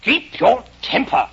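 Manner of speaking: low, slow, deliberate caterpillar voice. Acted. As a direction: spell it out slowly and imperiously, low. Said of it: "what did the caterpillar say".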